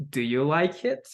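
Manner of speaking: "it" climbs at the end; yes-no question lifting on "it"; rising intonation. Shown as a demonstration. Teacher models a yes-no question, rising intonation. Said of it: "With rising intonation".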